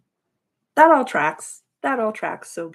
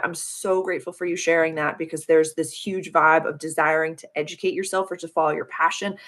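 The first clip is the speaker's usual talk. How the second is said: normal talking voice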